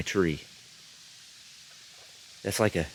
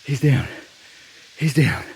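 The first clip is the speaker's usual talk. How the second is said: excited; 5 semitones higher than usual. Real, not acted. Hunter just shot a buck.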